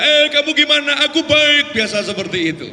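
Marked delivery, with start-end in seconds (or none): 0.0-1.7 high, loud country holler; villager's shouting talk. Retold or imitated. Villagers chatting at home, shouted. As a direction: call out as if across far-apart houses. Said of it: "kalau ngomong teriak-teriak".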